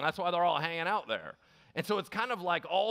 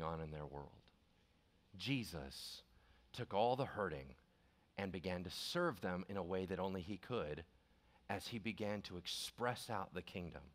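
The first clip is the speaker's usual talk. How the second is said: hushed preaching; 9 semitones lower, 9 dB quieter than usual. Acted. Preacher tells the congregation how Jesus served the hurting, hushed. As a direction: drop right down into quiet, solemn teaching.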